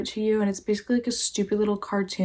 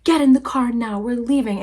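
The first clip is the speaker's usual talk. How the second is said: mock yell, louder and higher at the start; retold drunken yelling; 3 semitones higher, 5 dB louder than usual. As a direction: imitate him barking an order, raised voice. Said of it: "and he's yelling".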